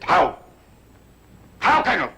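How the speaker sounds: pressing, accusing high-pitched questions; insistent interrogation. Acted. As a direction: voice leaping high on each demand. Major's interrogation builds to demand.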